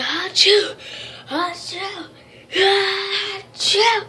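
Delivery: loud fake sneezes; three big fake sneezes. Shown as a demonstration. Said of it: "I'm like".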